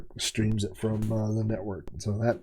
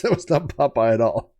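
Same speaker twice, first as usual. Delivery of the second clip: normal talking voice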